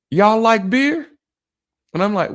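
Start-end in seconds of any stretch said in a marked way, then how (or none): 0.1-1.1 high-pitched imitation of a lady's offer; lady calling over. Lady outside her apartment offers two men drinks, calling over.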